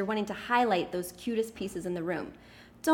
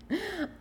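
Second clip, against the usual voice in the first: sheepish laugh; 10 semitones higher, 4 dB quieter than usual. Real, not acted. Admits breaking own rule.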